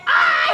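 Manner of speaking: very high, loud yelp; mock toddler cry. Nurse repeating the child's answer, mock cry. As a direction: squeal like a little child giving in. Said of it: "left crying and say".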